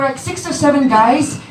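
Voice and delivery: ordinary talk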